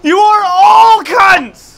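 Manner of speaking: slow, loud, high yell; angry shout. Real, not acted. Player cursing the whole team, shouting. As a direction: bellow the insult, stretching it out. Insulting teammates after the rant.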